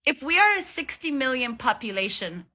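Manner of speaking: ordinary talk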